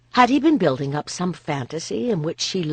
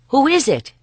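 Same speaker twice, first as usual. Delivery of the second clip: higher, louder call across the room; 11 semitones higher, 8 dB louder than usual. Acted. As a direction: call out to the entrance. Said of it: "Boudica called".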